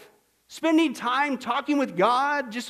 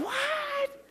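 squeaky mock surprise; 6 dB quieter than usual.

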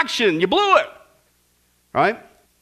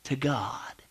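unvoiced, much softer; whispered aside; 10 semitones lower, 12 dB quieter than usual. Real, not acted. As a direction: drop to a whisper. Mock complaining aside.

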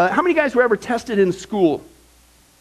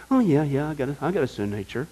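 mock-casual mumble, softer and fast; dismissive shrug; 6 semitones lower, 6 dB quieter, faster than usual. Preacher mimicking a believer shrugging off sin, dismissive. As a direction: toss it off like it's no big deal.

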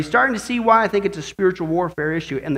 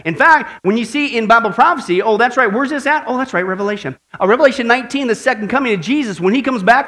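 ordinary delivery

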